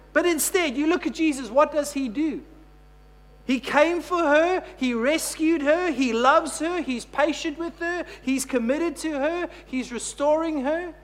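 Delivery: high, loud, rising preacher's cry; full-force preaching. Acted. Pastor lifts the church to its climax, preaching at full force. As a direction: proclaim it to the whole room.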